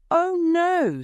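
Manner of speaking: high, loud fake wail of grief; a put-on cry of dismay; mock grief. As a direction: ham up the shock of loss. King fakes grief at death.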